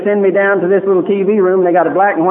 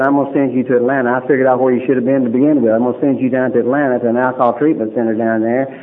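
brisk; 4 semitones lower, faster than usual. Retold or imitated. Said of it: "he said".

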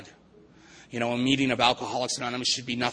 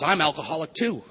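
hammed-up announcement, high and loud; mock proud declaration, pitch jumping up; recovered alcoholic; 5 semitones higher, 6 dB louder than usual. Recovered alcoholic jumps in beside the man, loud high mock announcement. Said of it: "jumps in the hole with him and goes".

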